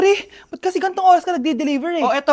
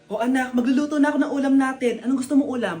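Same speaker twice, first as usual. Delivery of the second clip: normal talking voice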